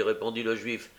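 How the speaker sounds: usual speaking voice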